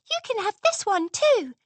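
high squeaky little filly. A narrator's reading. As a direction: squeak it nervously. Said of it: "Sweetie Belle squeaked".

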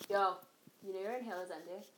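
soft, hushed aside; quiet. Real, not acted. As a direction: talk quietly to someone nearby. Talking to roommate off-mic.

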